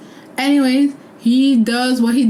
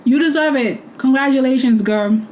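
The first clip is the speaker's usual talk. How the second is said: smug gloating; 10 semitones higher than usual. Retold or imitated. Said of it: "And I'm like".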